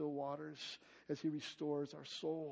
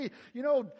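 mock instructing, higher and louder; bossy disciples; 6 semitones higher, 7 dB louder than usual. Disciples telling Jesus what to do, bossy. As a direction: play the disciples ordering Jesus around. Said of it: "disciples kind of instructing Jesus".

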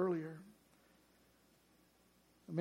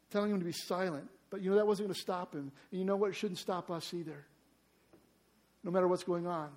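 usual speaking voice

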